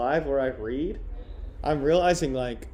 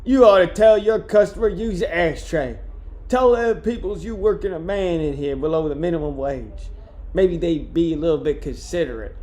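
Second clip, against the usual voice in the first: loud, higher start, complaining in character; griping sweeper character; 3 semitones higher, 4 dB louder than usual. Acted. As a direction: gripe at the boss as the put-upon sweeper. Voices the book's sweeper, Jones.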